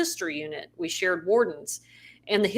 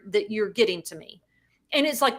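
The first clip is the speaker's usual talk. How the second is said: usual speaking voice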